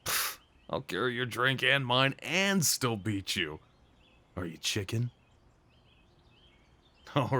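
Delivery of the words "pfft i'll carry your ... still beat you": ordinary delivery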